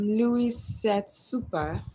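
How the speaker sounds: "Louis said super" read slowly, each word sounded out carefully; slow, careful stab at foreign words. Shown as a demonstration. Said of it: "Hope I got it right".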